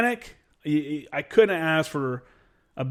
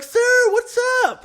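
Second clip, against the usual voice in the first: much higher, louder, slowed shout back; excited shouted greeting; 6 dB louder than usual. Retold or imitated. Mechanic greeting the major he finally found, excited shout. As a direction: yell a happy hello to the officer.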